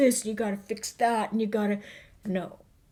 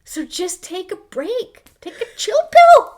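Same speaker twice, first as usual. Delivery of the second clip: playful squeal; 10 dB louder than usual. Acted. Playful chill-out advice.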